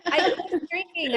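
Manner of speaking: said through a laugh; giggling while admitting it. Real, not acted.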